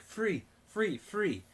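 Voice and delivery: high-pitched, repeated sales call; pushy bracelet seller. Retold or imitated. Hawker forcing bracelet onto her wrist, imitated high sales call. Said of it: "He's like".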